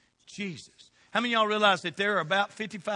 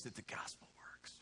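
whisper; 9 semitones lower, 20 dB quieter than usual. Acted. Hushed question to the congregation.

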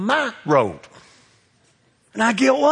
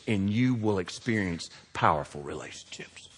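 whispered; 10 semitones lower, 10 dB quieter than usual. Real, not acted. Preacher drops voice for emphasis.

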